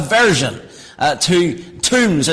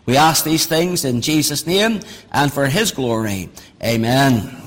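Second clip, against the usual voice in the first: prayer; 5 semitones lower than usual. Acted. Closing prayer before the sermon.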